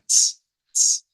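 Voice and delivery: "ts" as a crisp T released into S; isolated voiceless "ts"; affricate TS. Shown as a demonstration. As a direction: say "ts" with the T before the hiss. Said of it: "una T S".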